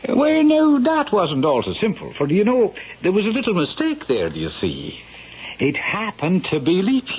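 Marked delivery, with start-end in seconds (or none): 0.1-1.1 excited exclamation. Acted. Storyteller answers the eager question.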